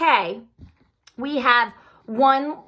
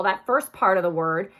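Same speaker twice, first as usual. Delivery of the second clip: normal talking voice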